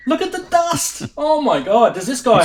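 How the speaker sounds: high, loud, amused disbelief; incredulous outburst. Real, not acted. Host showing viewers a filthy synth photo, incredulous. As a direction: exclaim it as if you can't believe your eyes. Reacting to very dusty listing photo.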